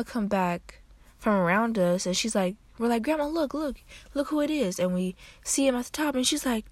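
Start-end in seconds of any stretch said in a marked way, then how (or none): 3.0-4.8 high, excited "look, look" to grandma; excited calling out. Retold or imitated. Calling grandma to look, high and excited. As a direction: point her out with a high eager call.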